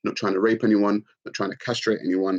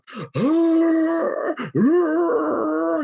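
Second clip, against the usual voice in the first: mock gasp. Mocking shocked snowflakes.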